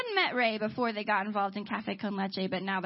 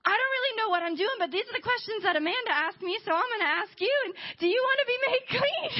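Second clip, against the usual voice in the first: high-pitched, excited rush; imitated eager invitation; 9 semitones higher than usual. New believer Ray asking Gabe, high and giddy. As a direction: pitch it high, bursting towards laughter. Acted Ray's giddy first evangelism.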